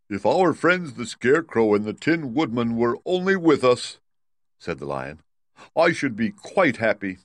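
a hint of a longing lion; wistful lion. Lion speaking of missing friends.

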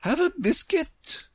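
very high regal offer; gracious Queen. A narrator's reading.

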